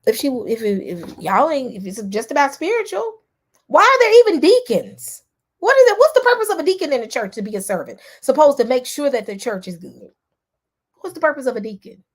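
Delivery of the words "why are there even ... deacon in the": much higher and louder, indignant; heated outburst. Real, not acted. Challenging Mike about deacons in a loud heated outburst. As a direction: let the anger burst out on the question.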